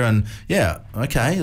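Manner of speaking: ordinary talk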